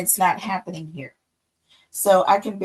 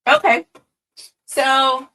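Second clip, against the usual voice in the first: excited burst; 5 semitones higher, 9 dB louder than usual. Real, not acted. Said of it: "bursts back after long pause".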